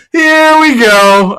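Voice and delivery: sing-song it up high, slow and loud; a long, rising announcer-style here we go; drawn-out high here we go. Acted. Repeats phrase, second drawn out.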